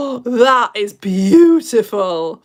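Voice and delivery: loud gasp then slow, loud praise; overwhelmed by the poem; awed gasp. Real, not acted. Host overwhelmed by Edmund's poem, loud awed praise.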